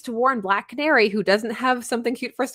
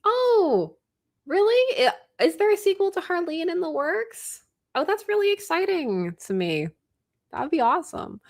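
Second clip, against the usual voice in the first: high delighted surprise; excited; 10 semitones higher than usual. Real, not acted. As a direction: squeal with genuine excitement. Excited about a sequel.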